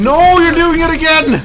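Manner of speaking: high loud exasperated yell; exasperated shout. Real, not acted. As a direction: shout at the teammate in despair. Scolding teammate.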